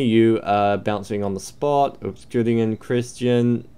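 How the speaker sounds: ordinary delivery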